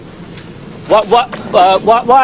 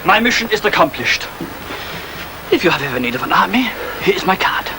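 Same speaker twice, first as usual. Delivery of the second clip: lightly voiced cool soldier, raised at the ends; ruthless mercenary; 6 semitones higher than usual. Kessler's parting shot to the officer, cool and mocking. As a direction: hand over the card with a smirk. Second take of parting line.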